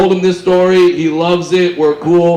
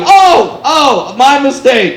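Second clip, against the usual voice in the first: sudden realization; 8 semitones higher, 5 dB louder, slower than usual. Retold or imitated. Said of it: "she goes like".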